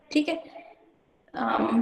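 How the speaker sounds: normal talking voice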